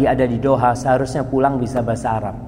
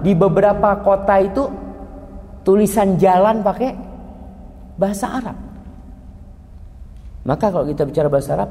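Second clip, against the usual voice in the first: ordinary talk, nothing marked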